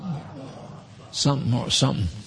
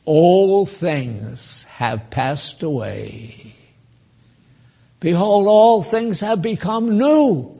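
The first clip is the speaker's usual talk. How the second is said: slow, drawn-out verse, rising in pitch on the second half; declaimed scripture; slower than usual. Real, not acted. Preacher quotes scripture on becoming new to the congregation, slow declamation. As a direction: let each phrase stretch out, then lift it.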